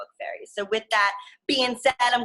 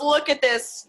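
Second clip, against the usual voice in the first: ordinary delivery, nothing marked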